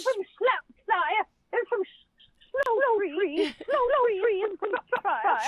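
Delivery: comic English old-lady impression; Missus Doubtfire. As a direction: put on a dotty British housekeeper voice. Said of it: "This is Missus Doubtfire".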